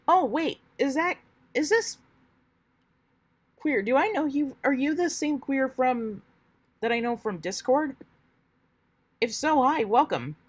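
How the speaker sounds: high, loud excited greeting; delighted to spot a friend. Real, not acted. Excited greeting to a known viewer.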